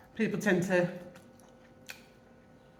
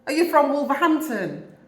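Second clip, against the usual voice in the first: higher, louder put-on American accent asking; American accent, a touch raised and louder; mock American accent; 7 semitones higher, 6 dB louder than usual. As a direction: imitate the American stranger's accent, curious. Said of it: "it was an American accent".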